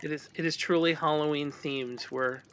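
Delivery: ordinary talk, nothing marked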